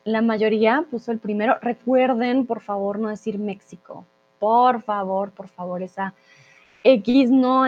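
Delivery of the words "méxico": "México" with the X said as KS; mispronounced X. Shown as a demonstration. Teacher begging learners not to say it, "México" with a KS sound. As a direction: say "México" as MEK-si-ko. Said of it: "no se pronuncia así".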